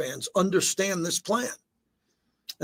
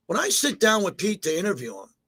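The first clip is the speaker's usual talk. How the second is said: ordinary talk, nothing marked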